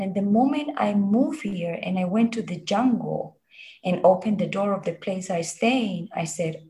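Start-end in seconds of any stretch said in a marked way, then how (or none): none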